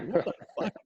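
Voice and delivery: soft, laughing what the fuck; laughing disbelief. Retold or imitated. Laughing at his cousin's frantic call. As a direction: breathe it out through a laugh.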